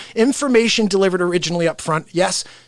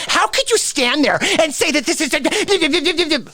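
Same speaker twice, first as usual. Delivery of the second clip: high, hammed-up accusing question; mock an indignant reporter, dissolving into gibberish; hostile reporter imitation; 7 semitones higher than usual. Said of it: "Listen to how they ask him questions".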